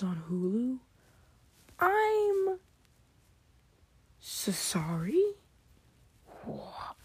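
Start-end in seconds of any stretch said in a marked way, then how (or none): 1.8-6.9 high outraged "I'm", then slow "so sorry?"; shocked disbelief. Real, not acted. Vlogger learning Thundercats is on Hulu, incredulous. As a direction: react like it's an insult.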